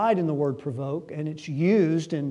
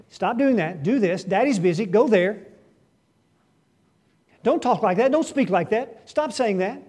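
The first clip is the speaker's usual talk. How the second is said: higher, louder impatient father barking commands; busy father's orders; 5 semitones higher, 5 dB louder than usual. Retold or imitated. Busy dad shutting down his child, impatient loud commands. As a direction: snap the orders out like a harried dad.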